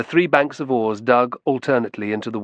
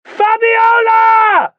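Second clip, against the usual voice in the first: much higher, much louder, slow drawn-out bellow of the name; full bellow; 9 dB louder, much slower than usual. A narrator's reading. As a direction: roar her name with everything you have. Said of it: "bellowed at the top of his voice".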